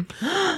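surprised gasp. Real, not acted. Surprise gift reveal.

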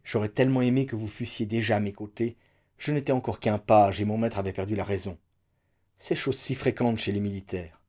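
ordinary delivery, nothing marked

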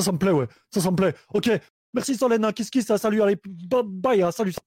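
normal talking voice

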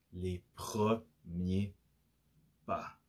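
"les premiers pas" very slow, syllable by syllable; dramatic slow reveal. Real, not acted. Host revealing today's topic, slow dramatic emphasis. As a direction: draw out each syllable, pausing before "pas".

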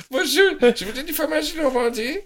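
high-pitched parody of a Normandy customer; mock Normandy local. Host mocking locals who order the specialty, high Normandy accent. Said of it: "il ne fait que des gens du cru qui veulent manger la spécialité".

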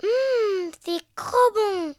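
put-on slow, savoring kid voice; munching and relishing the crêpes; little piglet with a full mouth. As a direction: porcelet gourmand, la bouche pleine. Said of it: "fit Billy le museau recouvert".

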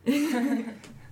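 loud laugh at being teased; amused laugh. Real, not acted. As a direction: laugh off the teasing about your accent. Amused at teasing about accent.

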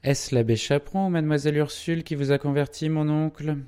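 lightly fawning, high-pitched question; fast, loud, ingratiating query; obsequious. A narrator's reading. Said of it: "avec une obséquiosité jésuitique".

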